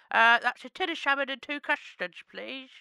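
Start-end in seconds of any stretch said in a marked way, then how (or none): none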